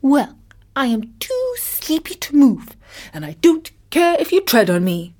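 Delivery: lightly voiced plucky tortoise, starting high; game little tortoise. A narrator's reading. Sleepy tortoise dares the elephant to tread, gamely. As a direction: shrug off the danger gamely. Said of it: "replied the little one gamely".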